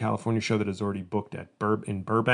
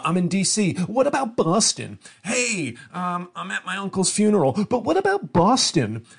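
high, loud, whiny fan impression; mock nagging fan; 9 semitones higher, 5 dB louder than usual. Comedian imitating fans who tweet from anywhere, shrill and whiny. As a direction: pester like an entitled fan at a funeral.

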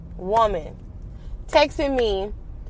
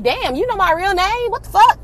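higher and louder, shocked she knows the name; shocked outburst; 9 semitones higher, 5 dB louder than usual. Retold or imitated. Girlfriend knows her real name, higher shocked outburst. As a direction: blurt it out in disbelief. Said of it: "And I'm like".

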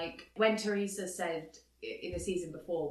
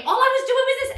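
much higher, louder insistence; mock indignant excuse; 12 dB louder than usual. Mimicking Teresa excusing herself to Kathy, much higher and louder. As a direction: insist it like a hammed-up defensive housewife.

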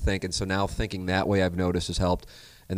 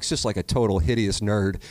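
ordinary talk, nothing marked

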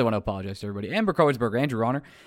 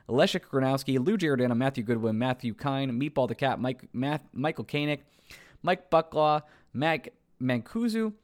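normal talking voice

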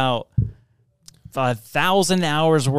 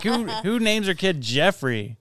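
usual speaking voice

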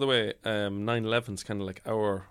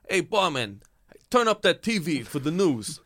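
calling out; 6 semitones higher, 4 dB louder than usual. Pretend. Patron calling to barman.

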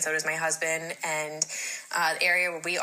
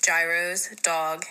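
normal talking voice

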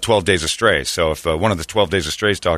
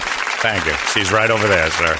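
usual speaking voice

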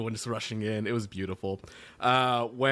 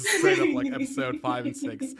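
excited; 13 semitones higher than usual. Real, not acted. Excited reaction.

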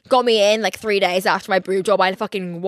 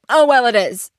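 loud, offhand imitation of a tactless doctor; raised-voice, breezy contradiction; blunt mock doctor; 3 semitones higher, 7 dB louder than usual. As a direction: blurt the correction out carelessly. Voicing the doctor's blunt correction.

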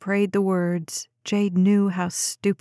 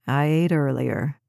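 low, calm male character voice; lowered man's voice; 6 semitones lower than usual. A narrator's reading. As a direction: voice him lower and steady. Ben answers.

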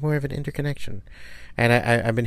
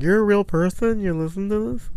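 surprised disbelief; 10 semitones higher than usual. Retold or imitated. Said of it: "I'm like".

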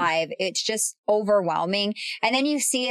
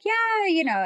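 high, mocking imitation of Spencer; lightly mocking Spencer's reply; mocking Spencer impression; 13 semitones higher than usual. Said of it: "He's like".

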